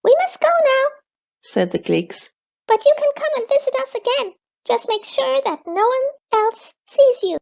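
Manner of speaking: very high, fast little creature; squeaky creature voice. A narrator's reading. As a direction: chirp it out like a tiny forest creature. Gleeks speak.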